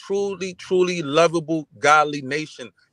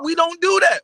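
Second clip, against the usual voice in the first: emphatic insistence; 11 semitones higher, 4 dB louder than usual. Real, not acted. Repeating insistence high.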